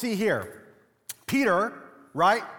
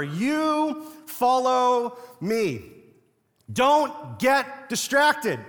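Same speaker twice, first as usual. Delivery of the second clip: very slow and deliberate, a touch higher; slow, emphatic command; 4 semitones higher, much slower than usual. Acted. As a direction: press the command home, word by word. Repeating Jesus's command for emphasis.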